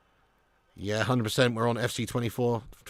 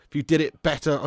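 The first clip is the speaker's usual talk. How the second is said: usual speaking voice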